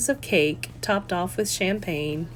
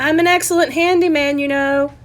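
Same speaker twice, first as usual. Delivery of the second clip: louder, higher dad calling from the room; father yelling out; 6 semitones higher, 5 dB louder than usual. Acted. Father calling out from the background, louder and higher. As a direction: shout it across the room like dad. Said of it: "her father yell out".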